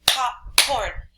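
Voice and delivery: "Popcorn" as two firm beats, pop and corn; clap-along chunks of "Popcorn"; syllable by syllable. Shown as a demonstration. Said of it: "I clapped it".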